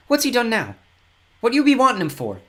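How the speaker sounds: suspicious mother, high and loud; mistrustful. A narrator's reading. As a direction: bristle at strangers asking after her son. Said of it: "her eyes glinting with mistrust".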